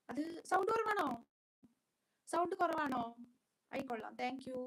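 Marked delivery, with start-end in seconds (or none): 0.1-3.0 higher, louder startled question; concerned query. Real, not acted. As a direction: ask loudly, taken aback. Checking after viewers report low sound.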